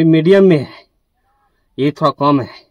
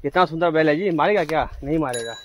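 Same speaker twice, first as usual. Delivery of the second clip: normal talking voice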